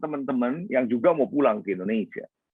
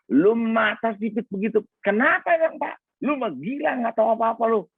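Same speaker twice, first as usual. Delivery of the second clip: high-pitched exasperated boss, imitated; mock telling-off, pitched well up; retold scolding; 7 semitones higher than usual.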